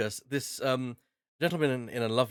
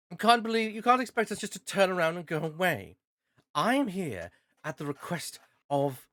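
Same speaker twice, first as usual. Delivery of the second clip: indignant baroness; 6 semitones higher than usual. Acted. Baroness arguing with the guard, high and indignant. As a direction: protest like an offended noblewoman. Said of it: "as the Baroness says".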